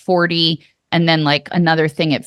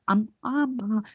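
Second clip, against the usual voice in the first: soft, wordless Muppet mumbling; Muppet mouthing; 9 dB quieter than usual. Retold or imitated. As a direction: lightly mimic a Muppet mouthing along, hushed. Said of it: "the voice is coming like a Muppet".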